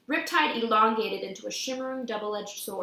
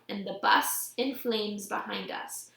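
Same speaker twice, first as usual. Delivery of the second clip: usual speaking voice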